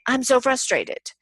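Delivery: frustrated. Retold or imitated. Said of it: "I was trying to say".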